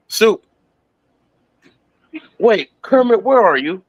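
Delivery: excited high parody voices; high cartoon voices. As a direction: pitch the cartoon puppets up high. Retelling Kermit and Cell cartoon.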